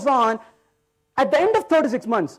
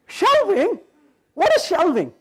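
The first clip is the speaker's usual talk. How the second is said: higher, laughing "Shelving? What is shelving?"; baffled disbelief; 5 semitones higher than usual. Retold or imitated. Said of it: "I'm like".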